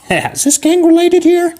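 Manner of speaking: high falsetto granny, questioning; hammed-up suspicious old lady. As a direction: put on a very high old woman. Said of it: "an old lady".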